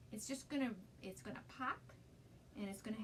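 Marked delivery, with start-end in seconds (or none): none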